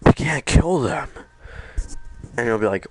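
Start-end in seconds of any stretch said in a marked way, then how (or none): 0.1-1.1 urgent plea. Retold or imitated. Josh pleading.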